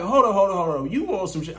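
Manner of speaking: a loud, urgent hold on; alarmed hold on. Retold or imitated. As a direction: stop her right there. Alarmed protest.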